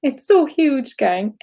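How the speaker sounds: high voice welling up again with feeling; overcome, slow and high-pitched; real tearful emotion. Real, not acted. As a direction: say it through rising tears. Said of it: "I guess I'm crying because".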